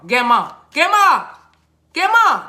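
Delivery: "grandma" said "GEM-ma", rising in pitch with each go; grandma as "gemma". Shown as a demonstration. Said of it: "Who used to call your grandmother grandma".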